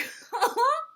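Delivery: high, loud burst of laughter. Acted. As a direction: laugh at your own joke. Kangaroo's laugh after joke.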